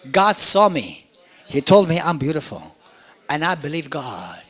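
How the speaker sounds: ordinary talk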